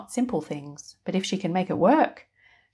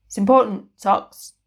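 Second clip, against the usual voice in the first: insistent; 4 semitones higher, 8 dB louder than usual. Acted. Wizard insists on clean socks.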